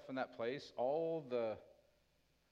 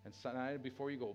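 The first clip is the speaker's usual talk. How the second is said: ordinary talk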